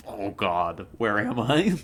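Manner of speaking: loud, dazed panic; confused shout. Retold or imitated. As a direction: yell like you just woke up lost. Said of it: "you're all like".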